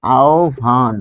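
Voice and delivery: আহ্বান as "ao-bhan", both parts lengthened; careful pronunciation. Shown as a demonstration. Teacher shows learners what it becomes, careful pronunciation. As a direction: say আহ্বান slowly with a bh sound. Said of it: "তাহলে এটি হবে".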